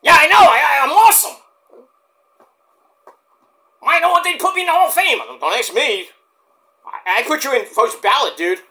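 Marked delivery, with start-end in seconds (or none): 0.0-5.2 high, loud put-on wrestler voice; hammed-up cocky wrestler; Mike Awesome impression. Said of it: "my good buddy, Mike Awesome".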